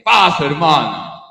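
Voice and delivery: slow, loud and drawn-out blessing; loud booming reply. Retold or imitated. The priest answering the shouting woman with a strong loud voice. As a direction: boom it back at her. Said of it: "yo también tengo voz fuerte".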